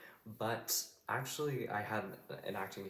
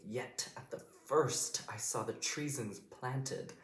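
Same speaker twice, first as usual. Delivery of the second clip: hard, crisp T's in "Yet at the first I saw the treasons planted"; slow and higher, striking every T in "Yet", "first", "treasons", "planted"; hit the T's; 4 semitones higher, slower than usual. Shown as a demonstration. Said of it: "you can really hit Antony with that, with those T's".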